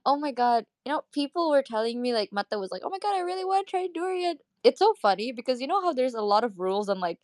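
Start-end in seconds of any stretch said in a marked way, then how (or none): 2.8-4.4 high, quick, softer imitation of an excited friend; eager friend imitation. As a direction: voice your friend gushing in a high, soft rush. Voices friend's eager wish for durian.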